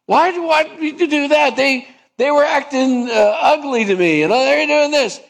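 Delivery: high, whiny complaining sinner; whiny protest. Acted. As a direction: whine like a sulking child caught out.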